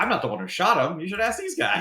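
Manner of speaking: high-pitched, defensive bystander; defensive cameraman. Acted. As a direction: plead innocence as a bothered photographer. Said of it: "the cameraman says".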